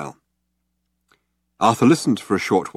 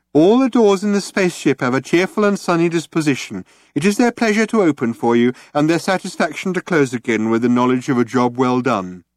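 high, bright mock sales pitch; sarcastic chirpy ad voice, pitched up; mimicking sales brochure; 6 semitones higher, 3 dB louder than usual. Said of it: "as he mimicked the style of the sales brochure".

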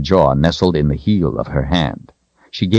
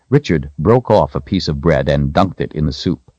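ordinary delivery, nothing marked

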